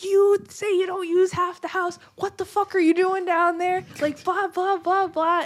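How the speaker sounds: high-pitched imitation of yelling at someone; retold drunken yelling. Drunk host confronting her roommate, retold high-pitched yelling. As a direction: rant it high, trailing into blahs. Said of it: "I'm, like, yelling at her".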